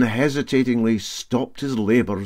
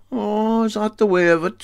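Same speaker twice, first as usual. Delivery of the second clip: high, raised mock surprise; 12 semitones higher, 4 dB louder than usual. Captain to Brodick man, feigning surprise. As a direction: act caught off guard.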